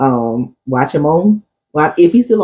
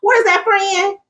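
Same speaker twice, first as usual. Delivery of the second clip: imitating Zoe; 12 semitones higher than usual. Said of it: "he always be saying".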